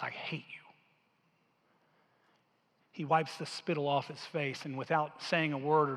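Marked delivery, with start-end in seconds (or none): 0.0-0.6 quiet hate. Acted. Enslaved woman spits at rescuer.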